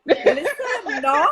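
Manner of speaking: high, loud, laughing; laughing reassurance. Real, not acted. Host laughing as she tells the guest no. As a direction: laugh it off warmly.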